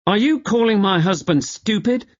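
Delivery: high, sharp indignant demand; rounding on him to defend her husband; indignant outrage. Acted. Wife defending her husband to Randolph, indignant and high. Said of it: "Felberta turned on Randolph".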